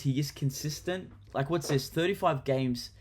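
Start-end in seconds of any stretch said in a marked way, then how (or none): none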